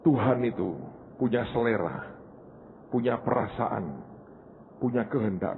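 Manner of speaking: a soft, deliberate closing of the sermon point; hushed closing refrain. Real, not acted. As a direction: let it sink in quietly.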